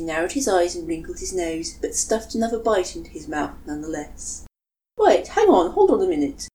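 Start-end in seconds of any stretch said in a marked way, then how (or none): 5.0-6.5 sputtering. Acted. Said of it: "Karamatsu sputtered".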